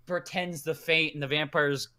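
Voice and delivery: normal talking voice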